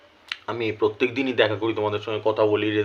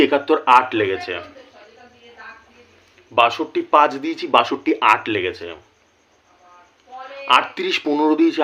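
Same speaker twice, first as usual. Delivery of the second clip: usual speaking voice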